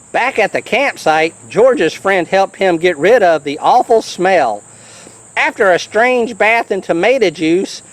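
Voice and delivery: usual speaking voice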